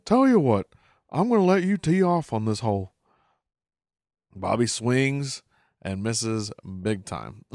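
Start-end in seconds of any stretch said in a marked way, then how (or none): 0.1-2.9 higher, lightly voiced Hank; a hint of proud dad Hank; imitating Hank. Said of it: "Hank says".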